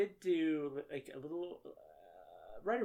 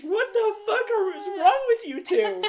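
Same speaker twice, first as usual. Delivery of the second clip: loud, high imitation of an angry dad; hammed-up outraged shout; mock exasperated yell; 14 dB louder than usual. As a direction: act out a dad yelling at kids. Retelling friend's dad yelling.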